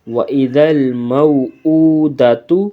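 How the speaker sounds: slow, melodic recitation of وَإِذَا الْمَوْءُودَةُ; Quran recitation. Shown as a demonstration. Teacher recites the verse slowly for the pupils. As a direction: intone it slowly like tajweed recitation. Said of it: "recitation demo".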